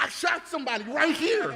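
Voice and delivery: loud, high shout out the car window; yelled out loud. Retold or imitated. Yelling out the car window to the pier, loud high shout. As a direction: blurt it out at full volume. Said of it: "I yelled it mad loud".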